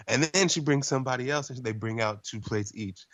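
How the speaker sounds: usual speaking voice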